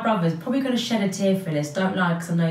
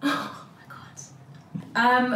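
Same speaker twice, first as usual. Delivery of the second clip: softer, drawn-out, overcome with real emotion; getting emotional; 5 semitones higher, 4 dB quieter, much slower than usual. Real, not acted. Vlogger overcome while talking about her brothers, tearful. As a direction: choke up on it. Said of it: "I'm getting emotional".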